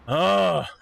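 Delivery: ordinary talk, nothing marked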